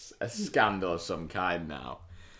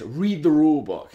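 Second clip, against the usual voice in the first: imitated scolding, higher and louder; insistent rebuke; 7 semitones higher, 6 dB louder than usual. Rival manager orders him to check, insistent. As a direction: snap it out as a retold telling-off.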